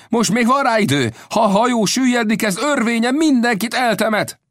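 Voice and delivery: very high, urgent shout; captain's alarm cry. A narrator's reading. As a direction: call all hands to flee. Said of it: "kiáltotta".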